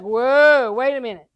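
acted-out startled halt; taken-aback husband. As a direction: imitate a husband putting on the brakes. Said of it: "He's like".

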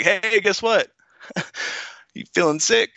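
high-pitched, singsong comic announcement; mock chirpy angel. Angel announcing to Mary she's pregnant, in a jokey high voice. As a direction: pitch it up like breaking silly news.

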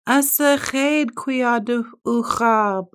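high slow wailing words; crying beaver. A narrator's reading. Lonely beaver wailing by the pond. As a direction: sob the words out slowly.

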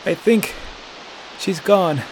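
out of breath, high; panting. A narrator's reading. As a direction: speak between breaths. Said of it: "panted Delson".

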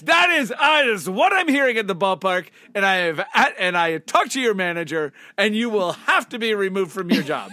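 loud, high scolding manager; mock stern boss. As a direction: play the outraged ballpark manager. Mock stern boss firing vendor.

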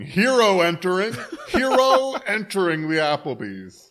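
loud high announcing yell; mock hero shout. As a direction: bellow it like a herald. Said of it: "opened the door to Applebee's going".

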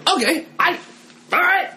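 mock the eager kid grabbing control; hammed-up voice of the kid in charge; bossy kid taking charge. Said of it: "And so I was like".

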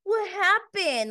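mock innocence, high and slow; Dan. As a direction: play clueless, drawing it out high. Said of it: "pretending not to know what he did".

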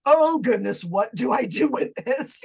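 high, slowing exclamation, retold; lightly acted fluster; mildly overwhelmed. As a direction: act out not knowing what to do. Acts out being overwhelmed by the message.

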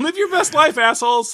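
high, loud, laughing delivery. Real, not acted. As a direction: laugh through the catchphrase. Catchphrase repeated laughing.

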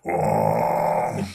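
deep car engine rumble; low engine groan. Retold or imitated. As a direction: growl it like a big SUV. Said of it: "you hear the Escalade just go".

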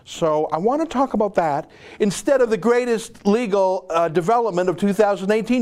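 lightly hammed-up imitation of a prim British presenter; mocking the BBC host in a British accent. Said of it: "I'm doing an accent".

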